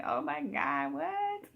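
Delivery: squeaky high disbelieving oh my God; high-pitched mock shock; shocked disbelief. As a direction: shoot way up in pitch, stunned. Shocked reaction to news.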